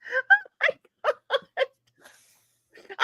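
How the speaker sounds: high, breathless laugh-cry; real helpless laughing; laughing to tears. Real, not acted. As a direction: crack up until it hurts. Helpless laughter after mix-up.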